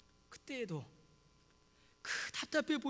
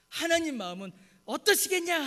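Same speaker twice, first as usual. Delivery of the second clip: raised-voice imitation of a scolding pastor; mock indignant rebuke, louder; 성질내는 목사님 흉내; 7 semitones higher, 7 dB louder than usual. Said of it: "하시면서 저희한테 승질 부리셨어요". (야단치듯 언성을 높여)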